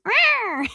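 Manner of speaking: high, sharp little animal screech, imitated; imitated raccoon cry. Storyteller imitating the trapped raccoon's high screech. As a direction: squeak it out like a cornered baby raccoon. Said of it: "and it's going".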